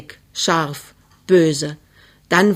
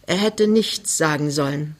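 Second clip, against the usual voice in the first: plain reading voice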